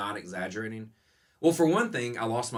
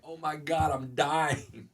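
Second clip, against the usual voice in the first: higher, mock dying wail; mock despair; 6 semitones higher, much slower than usual. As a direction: play up the overwhelm, half laughing. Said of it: "And I was like".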